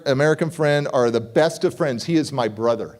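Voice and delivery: ordinary talk, nothing marked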